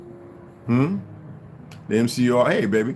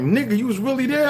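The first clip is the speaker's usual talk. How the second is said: amazed friend; 13 semitones higher than usual. Retold or imitated. Friend amazed, retold dream story.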